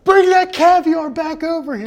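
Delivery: a teammate shouting for the pricey caviar back; freaking out. Retold or imitated. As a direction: yell it in disbelief at the price. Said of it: "He's freaking out".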